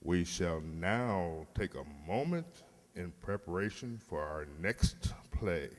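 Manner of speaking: a dry lugubrious host introducing the next tale; Alfred Hitchcock. Retold or imitated. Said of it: "In the words of Alfred Hitchcock".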